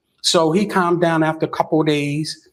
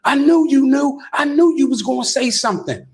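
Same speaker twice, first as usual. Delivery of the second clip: imitating a friend's accusing outburst; mock accusing outburst; 9 semitones higher than usual. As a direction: throw the accusation out high and hot. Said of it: "he spazzes out again".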